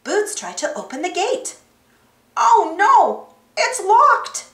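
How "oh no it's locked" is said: high, loud dismayed exclamation; groaning. A narrator's reading. Monkey finds the gate locked, groaning. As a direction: groan with frustration at being blocked. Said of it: "he groaned".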